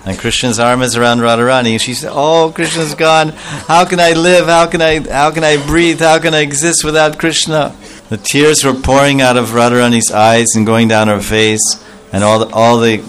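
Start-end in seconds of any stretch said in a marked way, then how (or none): 2.1-7.7 lamenting. Acted. Radharani lamenting Krishna's absence.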